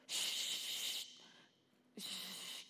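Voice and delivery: sharp hissed "shh"; acted shushing sound; silencing shush. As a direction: hush the negative voices. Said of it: "calla esas voces".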